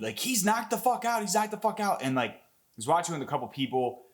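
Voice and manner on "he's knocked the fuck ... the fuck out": high-pitched excited yell, not full volume; retold scream. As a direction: re-enact screaming it out at the screen. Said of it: "and I screamed".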